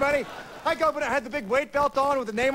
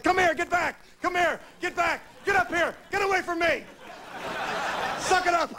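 mock drill sergeant yelling; 7 semitones higher than usual. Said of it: "always screaming and yelling at you".

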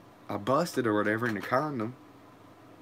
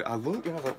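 ordinary talk, nothing marked

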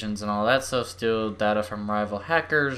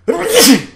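sudden loud high sneeze; 16 dB louder than usual. Real, not acted. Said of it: "sneezed again".

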